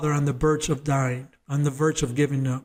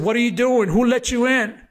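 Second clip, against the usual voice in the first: higher-pitched startled demand; 5 semitones higher, 4 dB louder than usual. Retold or imitated. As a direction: retell it as a startled, louder question. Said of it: "it startled him".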